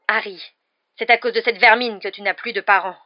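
loud, forceful rumble of anger; angry growl. A narrator's reading. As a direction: growl it bitterly. (grondement de colère)

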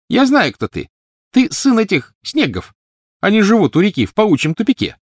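put-on very high, loud sneering girl; disdainful. A narrator's reading. Petunia looking down on the poor boy, disdainful. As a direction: throw it at him with contempt. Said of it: "по её тону ясно было".

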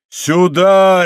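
long, loud, high shout; roared call. A narrator's reading. Steward summoning his men, roaring. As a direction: roar for help. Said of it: "ревел управитель".

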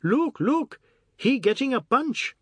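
very high, gleeful teasing in broken English; giggly Ajoda. Acted. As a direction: crow it high, as if tickled. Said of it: "broke off with a giggle and said in English".

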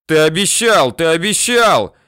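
high, loud, insistent protest; yelling. A narrator's reading. Bandit reminds the commissioner of his promise, yelling. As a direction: yell it like a desperate plea. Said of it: "орёт Адик".

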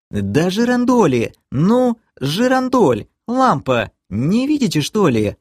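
high-pitched, exasperated protest; indignant, high-pitched outburst. A narrator's reading. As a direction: insist as if it were obvious, pitch jumping up. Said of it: "вознегодовала Кэлен".

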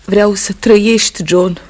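rising emotional plea; impassioned plea. Acted. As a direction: burst out with it, begging him to live. Wife pleads with husband.

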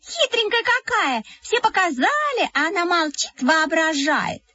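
high, mocking little girl's voice; teasing girl. Classmates mock the narrator, high and teasing. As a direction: taunt her for showing off.

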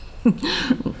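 light high giggle; a small coy laugh; short amused laugh. Acted. Widow laughs coyly when asked about the lawyer.

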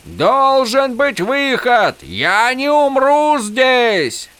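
very high, slow, stretched cries; drawn-out frantic shout; desperate yelling. A narrator's reading. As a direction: scream defiance into the storm. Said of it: "заорал Холлин".

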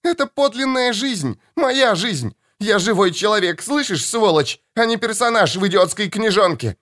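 put-on high, shrill-free angry young woman's cry; furious daughter. Daughter yelling.

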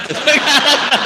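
high burst of laughter; real laugh. Real, not acted. Preacher laughing at his own joke, high burst of laughter.

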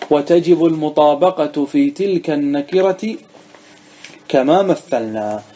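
measured reading aloud from a grammar text; slow text reading. Shown as a demonstration. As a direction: read slowly and deliberately, stretching the words. (قراءة المتن ببطء وتمهّل)

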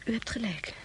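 soft unvoiced concession; whispered admission. Lisa concedes to the milliner's girl, whispering. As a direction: barely breathe it.

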